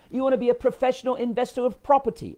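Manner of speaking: high-pitched hammed-up British accent; mock British accent. Teasing British investors about their title, mock British accent. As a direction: tease in a pompous put-on British voice. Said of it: "I'll murder the accent".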